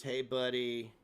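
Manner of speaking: usual speaking voice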